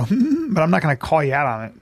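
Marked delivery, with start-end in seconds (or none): 0.0-0.5 a high, pointed hmm; mock sniff hum. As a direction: hum high to hint you noticed the smell. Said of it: "I'm gonna go".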